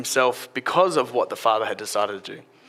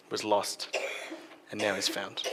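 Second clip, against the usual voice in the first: tender father; 6 semitones lower, 12 dB quieter than usual. A narrator's reading. Father tenderly reassures older son.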